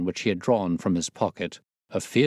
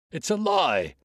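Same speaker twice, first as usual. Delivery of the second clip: shouting denial; 8 semitones higher than usual. A narrator's reading. Exposed trainer to Holmes, shouted denial. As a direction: fling the denial back at him. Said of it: "he shouted".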